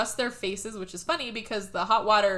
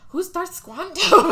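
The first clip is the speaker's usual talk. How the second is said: much higher, louder, slow, cracking up; baffled laughing question; 10 semitones higher, 9 dB louder, slower than usual. Retold or imitated. Zachary asks Frank about Squanto, baffled and laughing.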